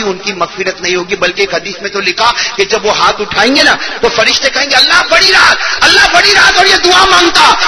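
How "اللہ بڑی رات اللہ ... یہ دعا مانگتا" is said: raised, high-pitched angels' appeal; urgent pleading. Acted. Angels pleading to Allah for a praying man, acted urgent cry. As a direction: cry it upward like an urgent plea.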